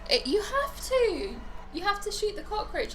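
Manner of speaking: high-pitched gaming urgency; excited urging. Real, not acted. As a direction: insist he shoots it now, all worked up. Urging a friend to shoot.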